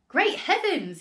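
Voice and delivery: high, loud exclamation; astonished cry. A narrator's reading. Father seeing the giant hen, high astonished cry. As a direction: cry out in amazement. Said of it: "he cried".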